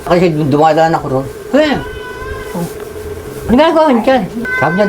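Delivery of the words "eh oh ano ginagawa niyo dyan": a high, surprised call upward; a retold surprised shout to friends upstairs. Sees them upstairs, surprised. (nagulat na tawag, mataas ang boses)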